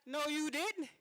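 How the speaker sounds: high, loud sassy scoff; mock-outraged, high and loud; sassy eye-roll. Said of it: "I rolled my eyes so hard".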